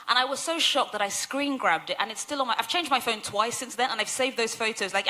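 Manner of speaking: ordinary talk, nothing marked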